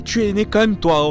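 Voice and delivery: normal talking voice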